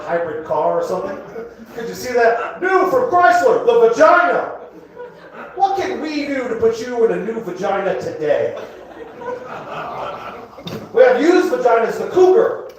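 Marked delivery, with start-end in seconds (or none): 2.6-12.7 car commercial pitch. Acted. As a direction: sell it like a booming car ad.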